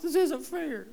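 put-on a kid protesting to mom and dad; whiny child. Parents hear kids whine this.

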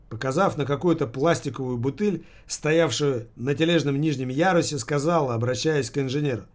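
ordinary delivery

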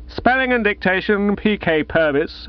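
echoed like a street hawker calling coals. Acted. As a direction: sing it out like a coal seller. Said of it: "as if he were calling coals".

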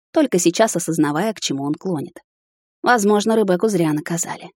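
plain reading voice

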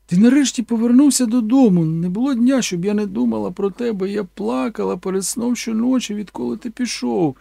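a mother pouring out her heart to her returned son; tearful mama. Acted. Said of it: "сказала вона".

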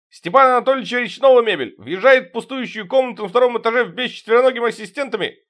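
high loud toady reporting; fawning report. Acted. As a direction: report like a flattering underling. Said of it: "льстиво приосанился и доложил". (льстивый доклад)

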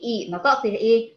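ordinary delivery

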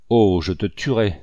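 ordinary delivery, nothing marked

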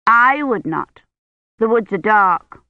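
put-on emphatic little-girl disapproval; a touch of childish firmness; passing judgment. A narrator's reading. Agnes judges the maiden in the tale, disapproving. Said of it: "passing judgment".

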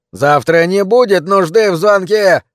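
high, loud, drawn-out retort; angry interruption. A narrator's reading. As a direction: cut in loudly, stretching the words. Patient angrily cuts in on doctor.

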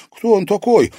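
high, loud startled question; stunned exclamation. A narrator's reading. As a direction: blurt it out in shock. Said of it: "выдохнул парень".

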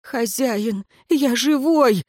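high, plaintive cry; near tears. A narrator's reading. Wounded servant assures master, nearly crying. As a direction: plead on the verge of crying. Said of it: "едва не плача воскликнул Тьенер".

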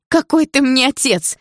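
high, loud indignation; drawn-out, high-pitched protest; flaring up. A narrator's reading. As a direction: challenge him, outraged. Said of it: "взвилась я".

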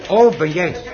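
surprised to hear a friend on the phone; the husband is startled by the caller's voice; surprised greeting. Acted.

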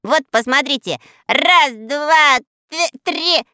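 loud proud countdown; boastful count. Acted. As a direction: show off, counting. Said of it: "громко". (хвастливо и громко)

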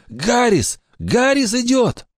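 high, frightened alarm; panicked warning. Acted. Panicked warning of approaching guard.

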